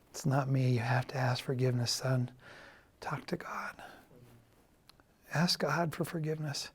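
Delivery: mother's words retold in a near whisper; hushed mother. Mother on the phone urging her son to pray, whispered. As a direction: hush it to a breathy whisper, a mother quietly pleading.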